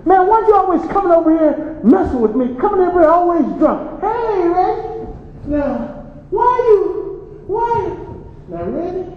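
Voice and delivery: put-on drunk taunting father. Drunk father taunting boxer son.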